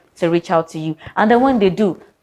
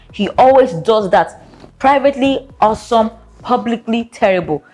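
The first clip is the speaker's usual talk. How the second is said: ordinary talk, nothing marked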